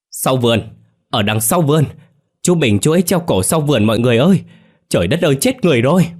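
high, loud, frantic yelling; panicked shout. A narrator's reading. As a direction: scream the alarm across the yard. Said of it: "vừa chạy vừa hét".

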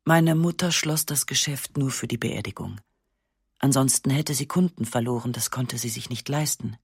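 ordinary delivery, nothing marked

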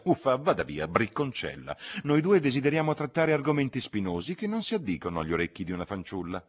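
high, theatrical spinster, teasing; Miss Lavish shooing Lucy. Acted. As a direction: shoo the girl away, archly. (zitella teatrale che scaccia la ragazza)

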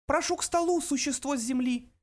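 very high, tiny creature voice; high-pitched little host; thin little voice. A narrator's reading. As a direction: squeak it out in a small, high voice. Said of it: "раздался тонкий голосок".

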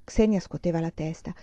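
usual speaking voice

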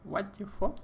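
high, put-on English "What the fuck?"; mock English disbelief. Friend reacting to Cockney rhyming slang, disbelieving. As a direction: act stunned in a foreign accent.

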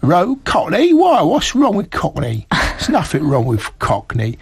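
mock-offended East Ender with a Cockney accent. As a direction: stand up for Cockney in broad East End speech.

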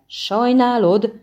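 loud, high outraged burst; exploding. A narrator's reading. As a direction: blow up at him with disbelief. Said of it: "robbant ki a hercegből". (kirobbanó felháborodás)

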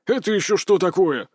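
put-on old man voice, baffled; puzzled old man. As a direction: grandpa squinting at the plate. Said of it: "с недоумением спросил дед".

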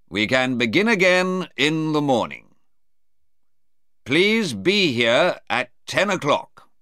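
a hint of a formal judge; judge adjourning. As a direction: announce it like a judge closing the day. Judge dismisses the court.

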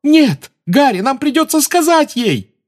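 high, desperate plea; pleading girl. A narrator's reading. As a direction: beg him, near tears. Hermione pleading. (отчаянная мольба)

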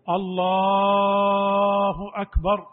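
الله chanted and stretched very long; overlong lengthening. Shown as a demonstration. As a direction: sing الله with an overlong drawn vowel. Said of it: "بعض الناس يمد لفظ الجلالة". (الله أكبر بمد مبالغ في لفظ الجلالة)

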